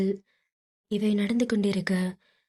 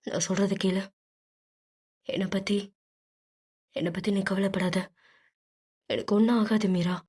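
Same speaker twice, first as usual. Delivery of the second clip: trembling voice; faster than usual. A narrator's reading. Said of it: "குரல் தழுதழுக்க".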